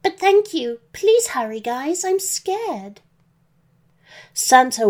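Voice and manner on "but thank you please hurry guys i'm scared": put-on high girlish voice, a touch tearful; lightly acted scared girl, pitched up; tearful young girl. A narrator's reading. Captive girl begs her rescuers to hurry, high and mildly tearful. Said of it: "Mary sobbed".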